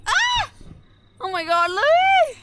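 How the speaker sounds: sudden high startled cry; startled yelp. Real, not acted. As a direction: yelp in surprise. Startled on the Unimog edge.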